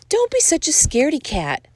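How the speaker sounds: high-pitched chiding fox. A narrator's reading. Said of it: "chided a fox".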